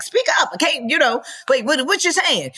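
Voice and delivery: high-pitched, exasperated call to speak up; mock straining to hear. Comedian to whispering castmates at table read, high and exasperated. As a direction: pitch it way up, pestering a mumbler.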